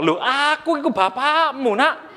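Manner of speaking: mock-hurt father correcting his son; playful indignant [name]. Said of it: "Lose bilang".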